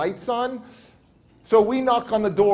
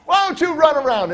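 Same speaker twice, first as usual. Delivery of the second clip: high, louder acted urging; imitated insistence; insistent suggestion; 8 semitones higher, 5 dB louder than usual. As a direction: push the suggestion again, pitched up. Man repeats his suggestion, escalating.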